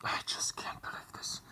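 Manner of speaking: whispered to yourself in defeat; whispered disbelief. Real, not acted. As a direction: breathe it out, no voice. Muttering in disbelief after silence.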